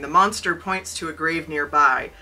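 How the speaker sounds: plain reading voice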